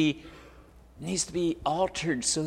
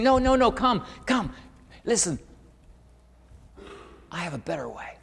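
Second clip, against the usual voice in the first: gentle God invitation; 3 semitones higher than usual. Pretend.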